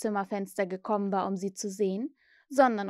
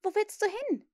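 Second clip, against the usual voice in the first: put-on high, wary girl's question; suspicious; 12 semitones higher than usual. A narrator's reading. Wendy sees Peter hurry to the window, suspicious. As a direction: call out suspiciously, pitch up. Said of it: "rief sie misstrauisch".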